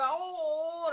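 long high wail; loud howl. Retold or imitated. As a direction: re-enact crying out in pain. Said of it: "as loud as I could".

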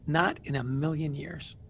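softly, slowly shut the offer down; a hushed, deliberate no; quiet flat refusal. Retold or imitated. Recalling turning down his friend Kay's counseling offer, quiet and slow.

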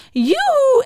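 very high, loud yoo-hoo; piercing witch's call; shrill. A narrator's reading. Witch hails the hedgehog, shrill. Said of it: "schelle stem".

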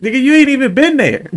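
high, loud disbelief; excited high teasing. Real, not acted. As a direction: pitch up in mock outrage. Teasing friend about Seattle.

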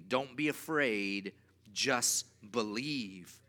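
slow, drawn-out recitation for all to repeat; slow call and response. Shown as a demonstration. Pastor leading the men in the verse, slow and drawn out. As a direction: stretch each word so the room can join in. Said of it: "Say it with me".

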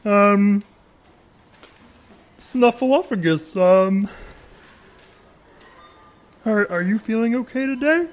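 high-pitched puppet character voice, drawn out; high Big Bird impression that lands close to Kermit. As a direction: put on a high children's-show bird voice, slowly. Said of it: "I will do my Big Bird impression".

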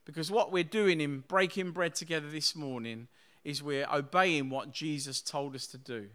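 usual speaking voice

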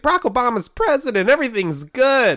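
high, bright-eyed mock voice; naive optimist. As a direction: play the carefree past self. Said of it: "He was just like".

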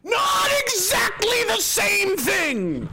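high, slow, drawn-out sarcastic understatement; sarcastic sing-song. Real, not acted. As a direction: stretch it out mockingly high.

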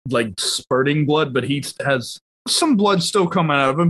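ordinary talk

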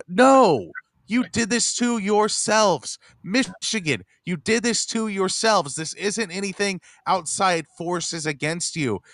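high, emphatic, exasperated rebuke; indignant scolding. Real, not acted. Fed-up podcaster telling Michigan they did it to themselves, indignant scolding.

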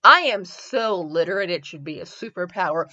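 normal talking voice

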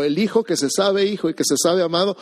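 ordinary talk

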